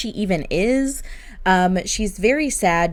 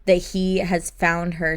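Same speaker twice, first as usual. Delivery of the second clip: normal talking voice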